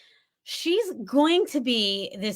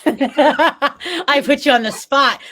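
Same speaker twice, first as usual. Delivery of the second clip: louder, slowed words through laughter; teasing laugh; 2 semitones higher, 9 dB louder, slower than usual. Real, not acted. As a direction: laugh while owning up to it. Teasing co-guest after surprise question.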